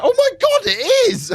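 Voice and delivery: high, loud squeal of mock surprise; mock shocked realisation. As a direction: gasp it out like you just recognised him.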